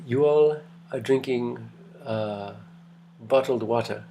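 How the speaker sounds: put-on slow, amused, lightly Kenyan British accent. Professor teases students about bottled water in a light Kenyan British accent. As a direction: savour each word in a hint of a Kenyan British accent. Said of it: "he had a very Kenyan British accent".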